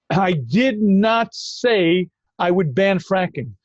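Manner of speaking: slow, deliberate mock denial; quoting Biden. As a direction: spell out each word slowly, like a careful politician. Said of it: "Joe Biden ... said".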